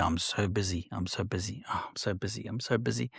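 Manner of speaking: breathy mock complaint of being swamped; mock busy whisper. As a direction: sigh it like an overworked martyr. Mocking busy people's complaint.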